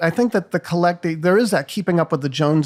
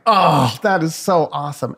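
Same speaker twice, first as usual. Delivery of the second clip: jealous awe; 3 dB louder than usual. Retold or imitated. Guest retelling hearing the host owned a football team, jealous awe. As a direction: re-enact envious admiration, opening on a big exclamation. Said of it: "I was fucking jealous as fuck".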